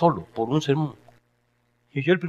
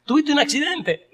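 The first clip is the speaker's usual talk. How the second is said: worried mother. Retold or imitated.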